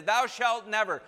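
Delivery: acting out a proud man laying down the law; imperious decree. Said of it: "this is the attitude".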